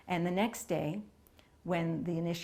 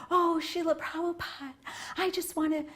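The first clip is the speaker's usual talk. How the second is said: high, gushy mock-feminine plea; gushing lady; 11 semitones higher than usual. Dolled-up English lady pleading with guru for initiation, imitated gushing. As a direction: put on a high, effusive fan voice.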